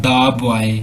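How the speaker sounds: put-on "the boy" pronounced "daa boy". Shown as a demonstration. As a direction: open aa in "the" before "boy". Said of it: "जिस तरह".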